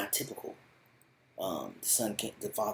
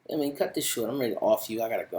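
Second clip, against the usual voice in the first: impatient threat; 3 semitones higher, 6 dB louder, faster than usual. Retold or imitated. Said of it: "And Esai like".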